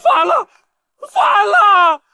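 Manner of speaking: high cries of official outrage; high, scandalized yelling; outraged alarm. Acted. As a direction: cry rebellion in high alarm. Official attacked by defiant villagers.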